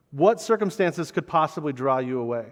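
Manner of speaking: usual speaking voice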